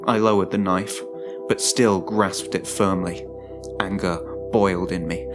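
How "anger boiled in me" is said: plain reading voice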